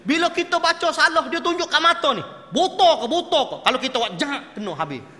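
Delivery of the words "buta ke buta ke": louder, higher scolding; mock scold. Quran teacher jabbing at a student's eyes, mock scold. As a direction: bark it like a stern teacher. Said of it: "teacher scolding student".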